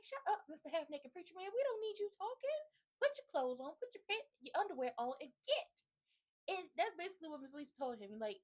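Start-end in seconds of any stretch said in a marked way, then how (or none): 0.0-5.8 high, loud telling-off, shooing him out; scolding. Retold or imitated. Mother ordering the preacher out, loud and high scolding. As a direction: chase the half naked preacher out of the room. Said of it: "He was like".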